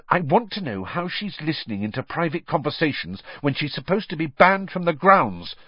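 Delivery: mildly angry, rising at the end; a touch of indignation. A narrator's reading. Hermione indignant about Skeeter spying, mildly angry. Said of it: "said Hermione angrily".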